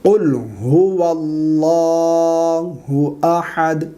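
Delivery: long drawn-out chanted recitation; slow Quran recitation. Shown as a demonstration. Teacher recites the surah for students, slow and chanted. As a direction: recite slowly, stretching every vowel.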